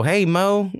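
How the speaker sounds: put-on small, high-pitched child calling out; little kid's voice. Kid calling to an adult at school, little voice. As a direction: imitate a little boy's greeting. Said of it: "I hear this little voice go".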